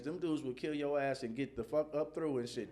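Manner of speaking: usual speaking voice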